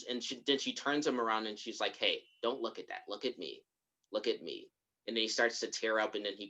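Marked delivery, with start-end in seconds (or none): 2.0-4.7 low, soft reassurance; soothing, retold. Grandmother turns the boy around, retold soft soothing. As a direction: gently draw a child's attention away.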